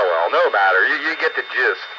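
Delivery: ordinary talk, nothing marked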